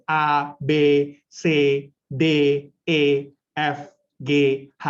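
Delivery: letters one by one, clearly separated; articulation drill. Shown as a demonstration. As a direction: mouth each letter distinctly with a pause between. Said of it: "latihannya di depan cermin itu ngomong".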